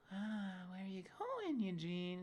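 high, drawn-out singsong question; mock nosy Connie.

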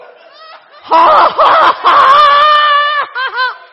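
high shouted laugh; loud hearty laugh. Real, not acted. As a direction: roar with laughter. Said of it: "big laugh".

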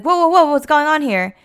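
high, loud startled whoa; loud shocked boss breaking it up; alarmed. Retold or imitated. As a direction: burst in alarmed at the fight. Boss bursts in on a fight.

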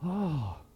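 soft, low "ah"; sigh of relief. Acted. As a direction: let out a quiet contented sigh. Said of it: "my soul goes".

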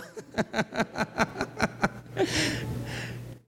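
risa soplada sin voz; reír casi en silencio; risa ahogada. Real, not acted. Risa tras el chiste. (el pastor ríe de su chiste, risa susurrada)

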